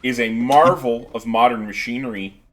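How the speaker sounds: loud hyped sponsor plug; ad read. Acted. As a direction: pitch it like an infomercial.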